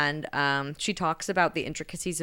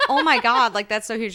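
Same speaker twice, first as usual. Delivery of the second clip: high and loud mock shock; retold squeal of shock; shocked gasp; 6 semitones higher, 7 dB louder than usual. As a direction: gasp it out like you can't believe the size. Zuri shocked at huge dildo.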